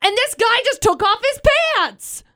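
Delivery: high, astonished storyteller's exclamation; retold disbelief. Acting out her stunned reaction.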